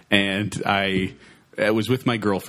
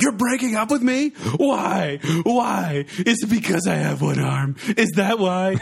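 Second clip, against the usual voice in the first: put-on high-pitched, overwrought teenage outburst; mock yelling; 11 semitones higher, slower than usual. Teen prank-yelling at girlfriend in line, mock yelling. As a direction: wail it in hammed-up outrage. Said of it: "I just start yelling".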